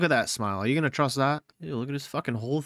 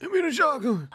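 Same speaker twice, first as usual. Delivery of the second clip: mock farmer yell; 13 semitones higher than usual. Gamer yelling the farmer's shotgun line back.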